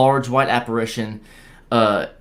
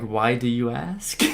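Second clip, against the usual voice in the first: retold shock, voice climbing at the end; mildly stunned, drawing out the question; 5 dB quieter than usual. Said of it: "me sh-, being shocked".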